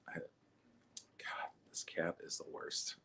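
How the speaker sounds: hushed whisper. Real, not acted. Comic whispering to the crowd about his cat. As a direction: whisper it under your breath. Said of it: "Well, it's live comedy".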